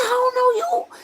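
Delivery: high-pitched, loud panicked denial, acted out; freaking out. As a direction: mimic a man yelling in shock. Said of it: "like, freaking out".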